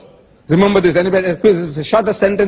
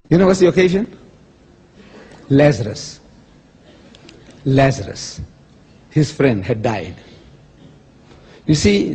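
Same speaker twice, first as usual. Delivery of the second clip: ordinary talk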